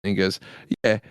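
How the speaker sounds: British lad telling a pub joke; matey put-on British storytelling; joke-telling stable boy. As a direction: lightly act out both sides in a British accent.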